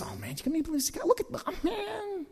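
muttering in traffic, hammed up; murmuring demo. Shown as a demonstration. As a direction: grumble to yourself about the other driver. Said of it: "Sounds something like that".

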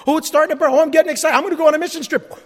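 quick, loud burst of eagerness; gushing mock enthusiasm; excited believer. Acts out a newly keen believer.

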